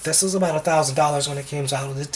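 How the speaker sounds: ordinary talk, nothing marked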